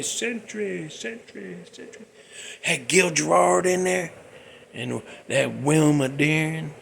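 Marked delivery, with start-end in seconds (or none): 0.0-2.1 title repeated with a fading echo. Pretend. Host mimicking a TV echo, fading echo. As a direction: let Century echo away into the distance.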